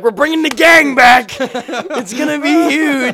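loud, high, drawn-out fake excitement; mock hype. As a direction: ham up a huge comeback announcement. Parody of overhyped comeback announcement.

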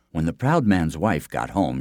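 ordinary delivery

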